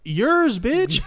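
loud, high teasing jab. Real, not acted. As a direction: shout it playfully at him. Teasing friend, shouting.

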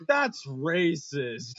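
slow, loud mock shout. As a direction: drag out a call of outrage. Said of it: "you hear Rebecca shout out".

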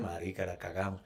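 very low, hushed confession; mock admission. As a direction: drop the voice as if owning up. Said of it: "hasta ellos dijeron".

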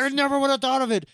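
high, fast, fawning director voice; gushing director. Retold or imitated. Grinch praising Max's acting, fast fawning gush. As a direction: gush it out quickly like a theatre luvvie.

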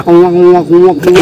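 repeated mimicked squawks; imitated "nguak" cry. Telling friends how it sounded, imitated squawking. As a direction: mimic the noise in bursts. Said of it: "Suaranya ya paling nguak".

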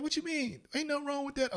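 squeaky high mock friend; imitating friend. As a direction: pitch way up, clueless innocent friend.

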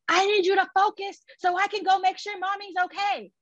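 high, strained near-yell; the scream held back, voiced high and tight; unspoken scream. Big sister to terrified little sister, a strained high near-yell. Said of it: "I don't wanna scream at my sister".

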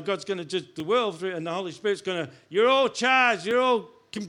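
ordinary delivery

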